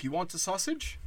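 a gentle, coaxing offer of food; mock friendly offer. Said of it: "We've always been like".

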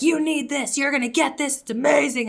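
high pushy hard sell, mock yelling. Mimicking a pushy seller yelling at a buyer. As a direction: pretend to push the product hard. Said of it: "you can just yell at me".

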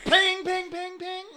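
sword ping sounds. Retold or imitated. Imitating sword clanging sound.

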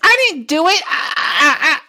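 high, squeaky denial with a nervous laugh; whiny henchman caught out, giggling; nervous henchman squeak. Acted. Henchman denies blame to his queen, high and squeaky.

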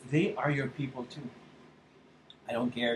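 holding back tears quietly; teary. Real, not acted. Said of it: "I'm getting a little emotional".

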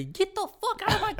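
shrill cartoon teacher kicking him out; high mock teacher yell. Mocking the teacher who throws him out, in a high squeaky voice.

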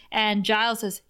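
ordinary delivery

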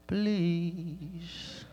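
long, stretched-out please; drawn-out plea. Real, not acted. Preacher pleading to move past a slide, drawn-out and soft. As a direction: stretch the word out, pleading softly.

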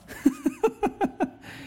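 a short, high burst of laughter. Real, not acted. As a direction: crack up at the memory. Laughing at own story.

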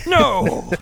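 high, loud mock cry of protest; over-the-top character wail; Torbjorn. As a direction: yell it as a hammed-up game dwarf. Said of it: "everyone can do a Torbjorn accent".

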